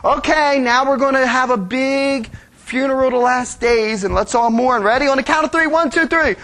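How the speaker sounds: put-on perky little kid taking a role; eager child volunteering to be the sad one; kids playing. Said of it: "kids play funeral".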